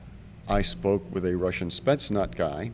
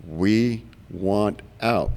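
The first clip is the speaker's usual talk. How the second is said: slow insistent demand; much slower than usual. Retold or imitated. One zero demanding extraction, slow and insistent. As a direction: drag each word out slowly. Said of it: "Well, have you been?".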